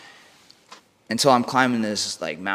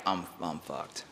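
defeated mumble; 5 semitones lower, 14 dB quieter, slower than usual. Retold or imitated. Lying defeated in a ditch.

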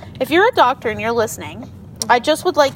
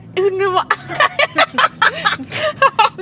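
high squealing laugh; laughing so hard it hurts; hysterical laugh; 13 semitones higher than usual. Real, not acted. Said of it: "I just hurt myself and I sounded like that kid".

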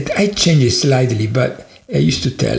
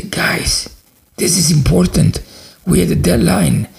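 louder, higher, urgent reprimand; stern protest; 5 semitones higher, 5 dB louder than usual. Retold or imitated. Boss to his team about the deadline, frustrated and insistent. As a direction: act out an exasperated boss pressing the point.

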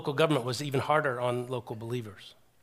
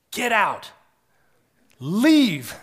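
higher, louder barked order; police order; 7 semitones higher, 9 dB louder, much slower than usual. Retold or imitated. Preacher retelling big policemen ordering Abdallah out, loud. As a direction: shout him out of the cell.

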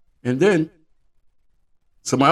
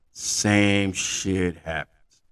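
very slow, stretched words; drawn-out refrain; much slower than usual. Acted. Comedian repeating the joke's refrain, very slow. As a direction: drag the line out for the laugh.

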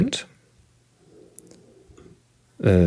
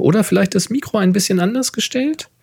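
reading aloud; 7 semitones higher than usual. Acted. Reads listener question aloud.

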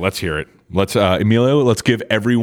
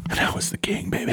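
whisper; 9 dB quieter, faster than usual. Real, not acted. Bragging about old gaming days.

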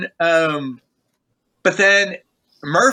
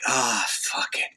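softer, slow give-up sigh of words; muttered resignation; 2 semitones lower, 7 dB quieter, slower than usual. Retold or imitated. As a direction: let it go under your breath.

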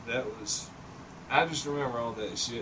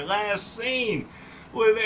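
high, loud quoting of the police scanner; 9 semitones higher, 5 dB louder, slower than usual. Retold or imitated. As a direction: read it out like a dispatcher. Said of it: "it said that on the police scanner".

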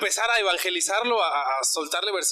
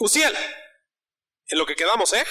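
calling out; 3 semitones higher than usual. Retold or imitated. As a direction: shout his name after him as he leaves. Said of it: "le grité". (gritándole su nombre mientras se va)

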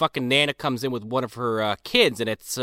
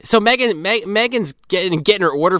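excited; 6 semitones higher, 5 dB louder than usual. Real, not acted. Excited retelling of scene.

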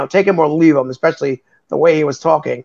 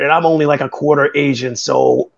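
ordinary talk, nothing marked

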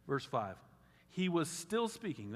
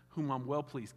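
ordinary talk

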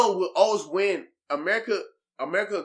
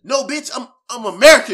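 mock indignant retort; 6 semitones higher, 10 dB louder than usual.

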